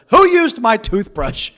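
put-on loud, high accusing kid's cry; mock kid yell. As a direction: shout it like an outraged child. Kids quarrel voiced.

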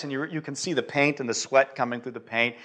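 ordinary talk, nothing marked

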